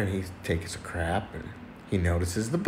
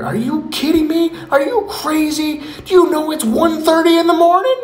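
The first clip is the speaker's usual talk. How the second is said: high, loud, stretched-out mock yelling; outraged neighbour; 6 dB louder than usual. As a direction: holler angrily through a wall. Neighbour yells through the wall.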